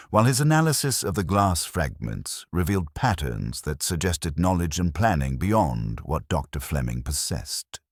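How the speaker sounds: ordinary delivery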